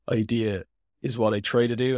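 ordinary talk, nothing marked

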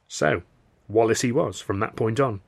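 ordinary delivery, nothing marked

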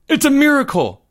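very high exclamation; breathless excitement. A narrator's reading. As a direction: cry out in amazed relief. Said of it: "now breathless with excitement".

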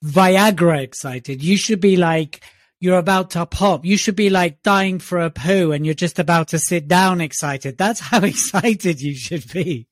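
usual speaking voice

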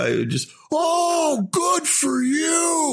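high, slow, shouted mock star rant. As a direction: screech it like an outraged movie star. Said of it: "guy was standing in his light".

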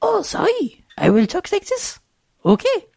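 put-on very high, squeaky child voice; little kid voice. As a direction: talk like a tiny preschooler. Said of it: "you have to use your little kid voice".

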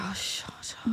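retold whispered shut up; whispered snap. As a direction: hiss it under your breath. Snapping at boyfriend in story.

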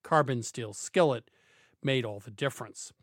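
smooth, measured commercial read. Acted. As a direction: read it like a radio sponsor spot. Host reads a car ad.

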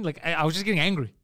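ordinary talk, nothing marked

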